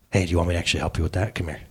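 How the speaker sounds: low, soft, quick murmured offer; imitating a caring father, hushed and low; tender offer. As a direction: drop right down and gently invite them over. Voicing the dad-God who helps.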